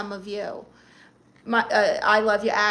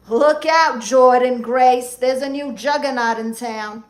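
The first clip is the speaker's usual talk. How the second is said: ring announcer hype; 6 dB louder than usual. Pretend. Streamer hyping a fan's arrival like a wrestler, loud announcer call. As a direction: boom it out like a wrestling entrance.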